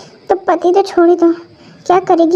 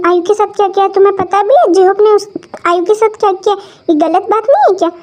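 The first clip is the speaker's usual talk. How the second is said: usual speaking voice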